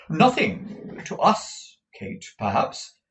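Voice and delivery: high, loud, slow "Nothing"; "Nothing" stressed high and drawn out; emphatic retort. A narrator's reading. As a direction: lean hard on "Nothing" and "us". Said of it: "Missus Nickleby emphatically".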